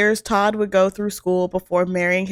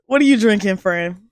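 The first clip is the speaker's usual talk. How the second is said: ordinary talk, nothing marked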